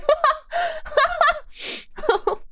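squealing high laugh breaking up. Real, not acted. Streamer cracking up at chat's joke, squealing laugh.

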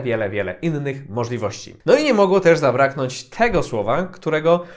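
ordinary delivery

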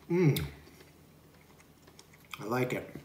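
chewing while talking; muffled with food in mouth; mouth full. Real, not acted. Seen 4: Bites topping at -1.0, chews closed-mouth through 4.9.

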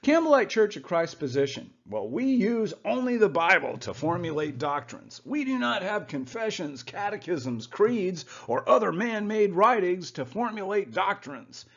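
high-pitched put-on spokesman voice; Campbellite spokesman. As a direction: recite the church's position as a little character. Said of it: "he's gonna tell you all about the Campbellite Church".

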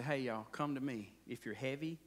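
quiet pastoral welcome; tender invitation. Retold or imitated. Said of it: "Hey, Jesus said".

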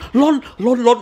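slow mock panic; mock flustered muttering; panicky. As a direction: fluster out the words. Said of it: "สมมุติกูลนเลย".

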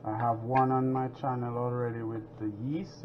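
ordinary talk

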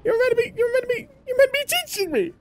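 mock whine. Whiny complaint to player.

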